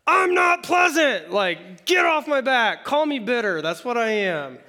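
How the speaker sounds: hammed-up bitter yell; bitter shout. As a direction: bark it back, done with the pleasantries. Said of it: "And she's like".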